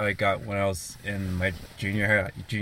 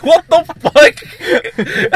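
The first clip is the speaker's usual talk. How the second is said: much higher, louder, slow, stretched out through laughter; laughing disbelief; 15 dB louder, much slower than usual. Retold or imitated. Reacting to his friend playing with the keychain all day, laughing.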